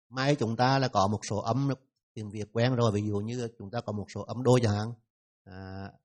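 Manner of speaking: ordinary delivery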